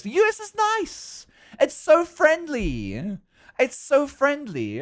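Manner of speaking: high-pitched, stretched-out praise; gushing enthusiasm. Real, not acted. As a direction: gush in a very high voice, lingering on the words. Gushing contrast to being scared.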